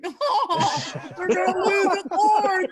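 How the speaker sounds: high, loud, slow through laughter; laughing mock despair. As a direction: wail it while laughing. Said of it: "making comments like that to the inside".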